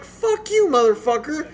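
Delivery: high, sharp mock cursing at the teacher; retold angry outburst. Retelling cursing out his teacher, with a high mock rage. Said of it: "I'm like".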